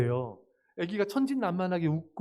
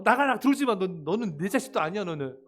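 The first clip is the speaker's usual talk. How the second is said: disown the child in a mock tirade; harsh parental scolding; 4 dB louder than usual. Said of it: "이렇게 막 야단치고 막". (부모가 야단치듯 몰아붙이기)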